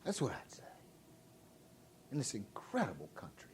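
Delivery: very soft, near whisper; hushed aftermath. Real, not acted. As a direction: drop right down after the big shout. Hushed drop right after the shout.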